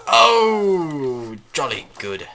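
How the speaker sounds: loud high "oh", then dropping British; mock-British cheer, lightly put on; silly British accent. Gamer praises the cannon shot, silly British accent. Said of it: "British accent for the French".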